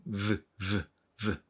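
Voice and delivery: "ブ" as a soft buzzed "vvv"; English v sound. Shown as a demonstration. Teacher drilling the letter V, buzzed v for "ブ". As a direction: buzz each "ブ" with lip on upper teeth. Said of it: "ブイの音ね".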